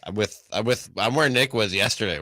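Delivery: usual speaking voice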